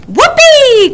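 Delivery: high, loud gleeful whoop; whoopee. A narrator's reading. Owl thrilled to catch the book. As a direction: whoop with delight. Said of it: "cried the owl".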